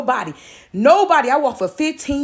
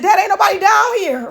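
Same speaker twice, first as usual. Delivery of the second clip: higher-pitched upset protest to dad; tearful complaint; 8 semitones higher, 4 dB louder than usual. Retold or imitated. Daughter on the phone to her dad, mildly tearful. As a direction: retell a mildly upset plea, pitch raised. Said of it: "And I'm still crying".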